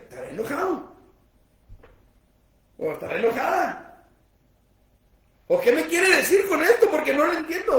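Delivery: high, loud puzzled texter, laughing; hammed-up confusion over a message; confused reader. Lector de WhatsApp confundido. (lector confundido por un WhatsApp, agudo y fuerte entre risas)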